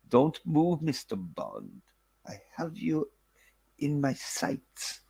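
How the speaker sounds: imitating a German doctor, hushed; German accent. Doctor threatening Bond, in a lightly imitated German accent. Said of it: "he does it in a German accent".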